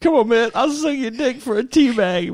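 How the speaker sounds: high, loud pleading whine; begging addict. Pretend. Host begging for a tea bag. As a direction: beg like a desperate addict.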